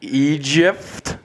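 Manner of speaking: "Egypt" with an f sound, like "ee-jift"; mispronounced Egypt. Real, not acted. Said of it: "He says Egypt".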